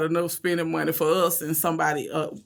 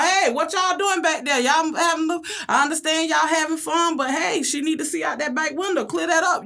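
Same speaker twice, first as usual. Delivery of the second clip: playful scolding; 9 semitones higher than usual. Retold or imitated. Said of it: "you don't have to tell them".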